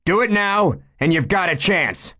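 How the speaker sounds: loud, high, pressing command; stern order. Acted. Chief pressing the gunman to surrender, loud and stern. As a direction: push him hard to give up.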